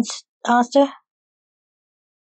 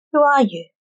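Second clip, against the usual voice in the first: mildly demanding question, pitched higher; 5 semitones higher than usual. A narrator's reading. Alien demands to know who Lance is, demanding. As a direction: demand it, lifting the pitch then falling. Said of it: "demanded the alien".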